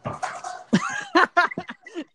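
loud, high-pitched laughing fit; real burst of laughter. Real, not acted. Listener reacting to the end of a prank story, loud laughter.

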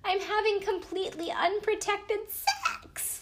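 high, slow, squeak on "sex"; weird squeaky voice. Retold or imitated. Woman confessing to her sister, high and slow with a squeak. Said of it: "squeaks on the word sex".

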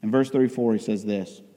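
ordinary talk, nothing marked

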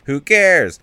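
loud, high "who cares"; dismissive outburst. Real, not acted. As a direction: burst out, brushing it all aside. Dismisses the joke being recapped.

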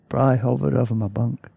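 plain reading voice

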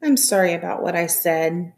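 plain reading voice

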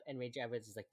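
ordinary talk

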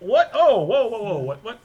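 high, loud mock alarm, easing off; overwhelmed bafflement. Listener swamped by double-speed talk, high mock-baffled outburst. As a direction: react as if it's all going too fast. Acting overwhelmed by too-fast speech.

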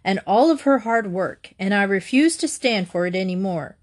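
ordinary delivery, nothing marked

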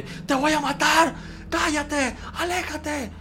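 high-pitched mock yelling, slow and threatening; imitated sleep shouting. Sisters recall the boy shouting threats asleep, high mock yells. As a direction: imitate a child raging in his sleep. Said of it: "yo de pequeño gritaba mucho".

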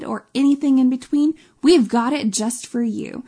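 ordinary talk

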